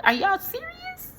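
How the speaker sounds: high, slow, incredulous squeal; can't believe the upcharge; shocked high-pitched question; disbelief. Real, not acted. Outraged at takeout upcharge.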